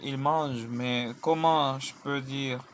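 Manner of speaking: ordinary delivery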